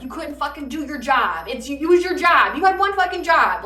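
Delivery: loud, furious scolding; ancestors' anger. Retold or imitated. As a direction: blast him for failing the one job. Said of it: "the anger they feel is just like".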